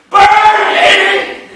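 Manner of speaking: very high, loud, falling yell; shouted cue. Shown as a demonstration. Teacher leading the students' chant, loud shout. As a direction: shout it with the class. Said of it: "pick Nene up and go".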